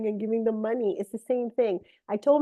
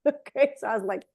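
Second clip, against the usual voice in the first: ordinary delivery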